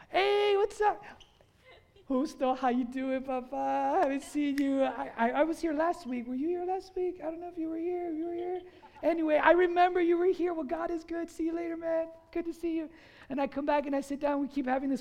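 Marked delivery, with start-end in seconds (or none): 0.1-1.0 cheery greeting. Retold or imitated. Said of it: "and I'm like". 2.1-12.9 light, distracted small talk with a friend; chatty friendly catch-up. Pastor acting out chatting to a passing friend, lightly voiced.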